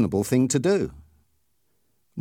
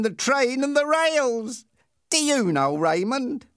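cruel Ernie, taunting; 9 semitones higher than usual. Acted. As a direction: toy with the victim. Ernie taunts the tied boy.

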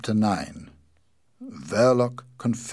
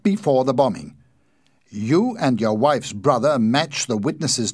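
loud, raised, emphatic police accusation; accusing inspector; 4 semitones higher, 9 dB louder than usual. A narrator's reading. Inspector confronts suspect over the bombing, forceful accusation. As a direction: lay out the case forcefully.

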